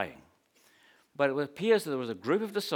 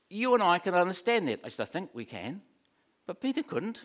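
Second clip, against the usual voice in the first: ordinary delivery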